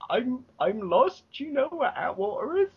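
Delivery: imitating scared self asking a cop for directions. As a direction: stammer it like a lost, frightened tourist. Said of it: "I was scared".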